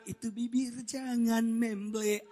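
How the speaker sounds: slow, drawn-out mock whine; teasing husband. Husband teases his sulking wife about her pout, drawn-out. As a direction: stretch the words out teasingly. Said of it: "Saya suka bilang".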